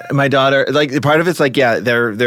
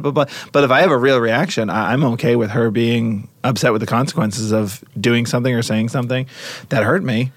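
ordinary talk

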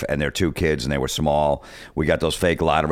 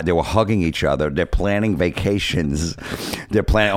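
ordinary delivery

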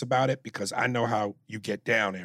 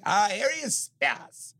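higher, showy "Ah, here he is" greeting; Jon Lovitz impression; 10 semitones higher, slower than usual. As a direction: greet him grandly like Lovitz at the Laugh Factory. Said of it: "John Lovitz when he would see me".

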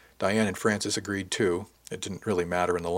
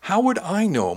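high, louder indignant question; indignant protest; 11 semitones higher, 5 dB louder, slower than usual. A narrator's reading. Shipmate defending his ignorance of clothes, indignant. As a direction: protest with pitch shooting up.

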